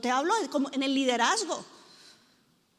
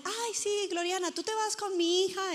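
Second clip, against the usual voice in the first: mimicking friend; 6 semitones higher than usual. Friend asks to take daughter.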